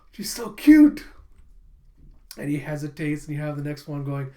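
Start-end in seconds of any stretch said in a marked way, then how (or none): 0.1-1.0 very high, loud gushing squeal; smitten outburst. Retold or imitated. Boy melts at the crying girl, smitten. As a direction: explode with helpless adoration, hammed up.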